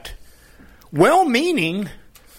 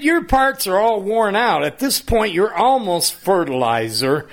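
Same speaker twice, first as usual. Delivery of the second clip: mocking taunt; 6 semitones higher than usual. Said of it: "They said".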